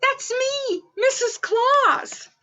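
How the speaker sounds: high, loud cry of delight; delighted shout. Acted. Mrs Claus spotting her own photo, delighted cry. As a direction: squeal at seeing herself.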